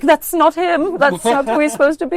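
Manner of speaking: high, loud indignant protest; mock outraged author. Author seeing the film adaptation, mock outraged. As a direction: play an author offended by the film. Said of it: "and they're like".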